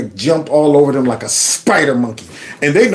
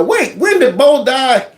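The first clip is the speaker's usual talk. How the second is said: put-on higher, slow, confused old man; mock confused Joe Biden; 10 semitones higher, slower than usual. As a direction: play a lost, forgetful old man. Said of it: "hear Joe talking about the".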